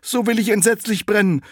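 the dung heap asks the running cart, high and loud; little dung heap. Acted. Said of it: "Da sprach das Misthäufelchen".

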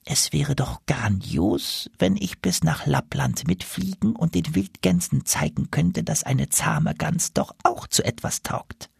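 put-on dreamy old gander, slowly. Goose dreaming of Lapland.

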